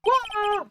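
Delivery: high terrified scream; short piercing shriek of terror. Acted. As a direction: let out a bone-chilling scream.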